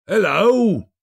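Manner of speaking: high feigned gruff shout; mock growl. A narrator's reading. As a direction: Scrooge pretending to be cross. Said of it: "growled Scrooge in his accustomed voice".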